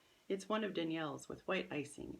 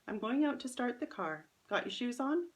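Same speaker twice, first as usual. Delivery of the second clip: higher, louder mom voice calling ahead; a mother calling upstairs, a little higher and louder; 7 semitones higher, 5 dB louder than usual. Acted. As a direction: sing it out brightly to hurry the kid along.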